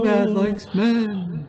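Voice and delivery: very high, slow ghostly wail; creepy ghost voice. Pretend. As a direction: draw it out like a moaning ghost.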